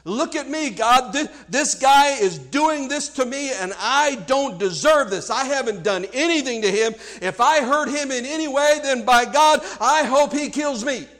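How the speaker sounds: loud, indignant acted self-justification; self-righteous self-defense. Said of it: "self-righteousness and the self-defense that's in that".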